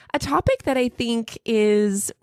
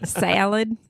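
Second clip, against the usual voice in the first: American "salad" with a nasal a; nasal American accent; slower than usual. Shown as a demonstration. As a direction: stretch the American a through the nose. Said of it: "It's very nosy".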